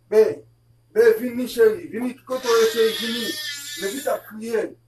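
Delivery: loud, insistent summons; urgent calling out. Real, not acted. Parent calling chérie to come, loud and urgent. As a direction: shout for someone to come now.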